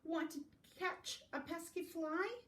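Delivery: high-pitched rooster invitation; high, lilting rooster question; storybook rooster. Acted. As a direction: ask eagerly in a high bird voice. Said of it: "crowed the rooster".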